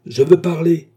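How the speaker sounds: loud, high demand to be heard; insistent shout. A narrator's reading. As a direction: shout over the magistrate. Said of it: "criait l'homme". (cri insistant, fort et aigu)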